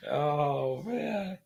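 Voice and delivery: ordinary talk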